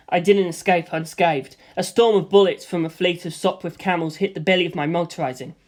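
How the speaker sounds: plain reading voice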